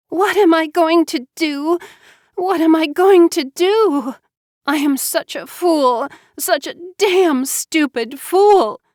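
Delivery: high, loud despairing wail. A narrator's reading. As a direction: berate herself in tearful despair. Pregnant girl despairing alone, sobbing.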